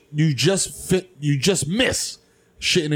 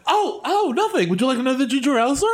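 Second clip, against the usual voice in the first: high, fast, flustered attendant; nervous flight attendant; 8 semitones higher than usual. Pretend. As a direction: cover panic with politeness. Flight attendant voiced in sketch.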